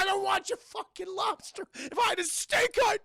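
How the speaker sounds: high, loud, crying and shouting. Retold or imitated. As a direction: wail it in furious despair. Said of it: "I need a goddamn steak".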